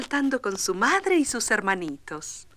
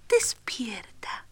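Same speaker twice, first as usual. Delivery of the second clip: slow whispered wake-up; softly; 4 dB quieter, much slower than usual. Acted. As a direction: gently coax the baby awake. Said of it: "le dijo suavemente".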